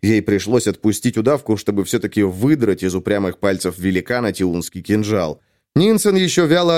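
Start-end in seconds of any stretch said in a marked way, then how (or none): none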